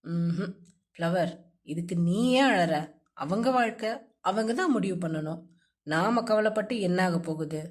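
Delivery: lightly voiced male friend. A narrator's reading. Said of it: "என சமாதானம் செய்தான்".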